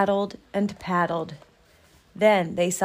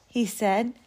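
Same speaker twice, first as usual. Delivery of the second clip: usual speaking voice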